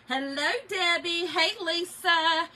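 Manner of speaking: ordinary delivery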